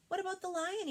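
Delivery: put-on high, eager little boy voice; high child voice. Little Freddie asking his mother, high and eager. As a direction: pitch it up as a young kangaroo.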